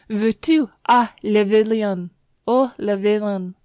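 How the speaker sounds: loud, high French scolding; scolding the dog. Acted. Landlady scolding her poodle, loud French scolding. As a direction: tell off the naughty poodle.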